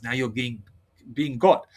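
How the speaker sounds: normal talking voice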